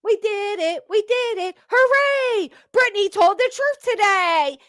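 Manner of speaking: hammed-up victory chant; giddy cartoon triumph in mockery; mock celebration. Mocking cheering moderator.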